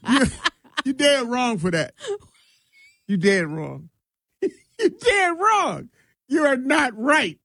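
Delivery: high laughing mock outrage; laughing protest. Real, not acted. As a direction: protest while cracking up.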